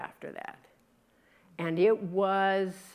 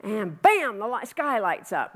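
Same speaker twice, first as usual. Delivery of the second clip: ordinary talk, nothing marked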